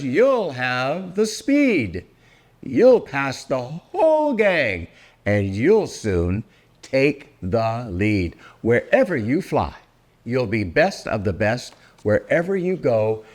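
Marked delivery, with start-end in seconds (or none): none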